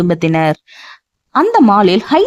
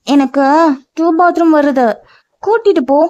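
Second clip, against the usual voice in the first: put-on childlike voice; 6 semitones higher than usual. A narrator's reading.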